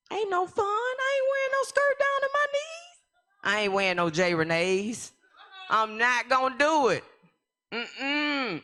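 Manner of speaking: squeaky high, loud mock whine; whiny refusal. Her younger self refusing church clothes, a squeaky mock whine. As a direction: whine like a stubborn teenager.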